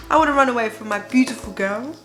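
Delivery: ordinary delivery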